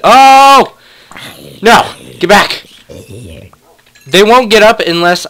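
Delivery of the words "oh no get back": sudden loud, high "oh!"; startled. Real, not acted. Player startled by danger near her wolves, sudden loud cry. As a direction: jolt in alarm, then warn them off.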